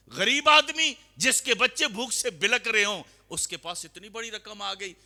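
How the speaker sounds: high, loud preacher's cry over the poor man's luck; preaching at full force. Acted. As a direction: lift the crowd with a ringing cry. Preacher raises voice mid-story.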